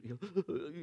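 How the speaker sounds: soft mock hitching sobs; imitated sobbing breath. Shown as a demonstration. Pastor showing the congregation deep crying, mock sobbing. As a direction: imitate trying to talk through deep crying. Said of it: "you ever try to talk when you're, like, really deep".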